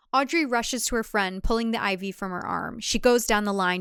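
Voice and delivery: ordinary delivery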